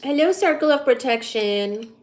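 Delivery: ordinary talk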